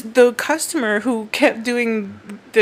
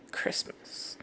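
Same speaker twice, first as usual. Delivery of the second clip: whispered; 18 dB quieter than usual. Retold or imitated. Huffy customer's parting line.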